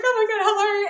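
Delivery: high, loud, gushing hello; excited greeting. Retold or imitated. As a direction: act out a thrilled hello. Her retold excited hello.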